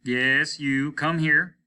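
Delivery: loud, slow, firm command; ordering. Acted. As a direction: make her obey at once. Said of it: "ordered the captain".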